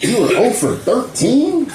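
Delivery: lightly voiced high mocking ex; mocking ex-girlfriend. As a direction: pitch up to mock him as the ex. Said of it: "she was like".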